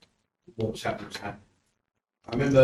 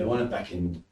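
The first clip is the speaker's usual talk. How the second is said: ordinary talk, nothing marked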